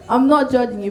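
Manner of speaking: ordinary talk, nothing marked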